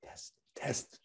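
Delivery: silent lip-read words; barely breathed, mouthing it; mouthed whisper. Shown as a demonstration. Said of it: "Can you read my lips?".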